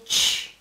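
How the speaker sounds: ch sound on its own, as in "touch"; sonido ch. Shown as a demonstration. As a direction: say an isolated, voiceless "ch". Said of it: "acaba en el conjunto". (sonido «ch» aislado)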